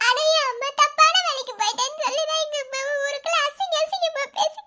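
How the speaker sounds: grief-stricken sobbing lament; tearful outpouring, gasping between phrases; crying. Acted. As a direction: push the words out through heavy sobs. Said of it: "Tamil அழாதம்மா".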